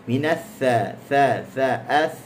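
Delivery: "ath" with a flowing, breath-carried th; soft airy th. Shown as a demonstration. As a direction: let air run through the th in "tha-". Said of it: "Dia ada rokhawah, dia ada hams". ("ath" dengan rokhawah dan hams)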